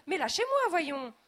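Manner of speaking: very high, very loud scream. A narrator's reading. Lady held aloft by the robot, screaming. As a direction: shriek to be let go. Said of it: "hurle madame Dupressoir".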